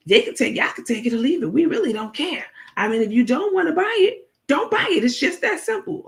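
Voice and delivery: high, sassy take-it-or-leave-it voice; Take's dismissive reply. Retold or imitated. Take brushing off angry fans, high sassy dismissal. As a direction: toss it off with a high, careless sneer. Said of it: "Take said".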